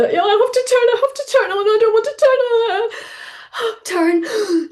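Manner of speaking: high, gasping, hammed-up panic at the slope; a retold fright, voiced high and breathless; mock panic. Retold panic on icy ski run.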